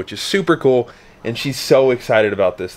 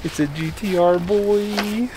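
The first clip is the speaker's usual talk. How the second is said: normal talking voice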